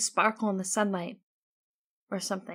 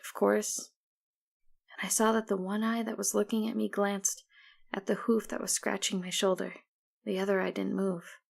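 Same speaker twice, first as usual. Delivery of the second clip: ordinary delivery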